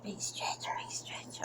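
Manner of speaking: breathy soft whisper. Acted. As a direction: whisper it as the cat stretches. Cat stretching, whispered.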